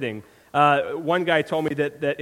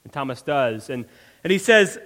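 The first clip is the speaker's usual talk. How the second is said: normal talking voice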